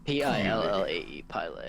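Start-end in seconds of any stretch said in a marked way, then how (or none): 1.3-1.6 pillow said "pie-lay"; joke mispronunciation. Shown as a demonstration. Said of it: "the O is silent".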